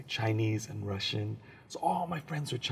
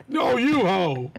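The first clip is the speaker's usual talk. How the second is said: laughing retort; 9 semitones higher, 8 dB louder, much slower than usual. Retold or imitated. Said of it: "I was all".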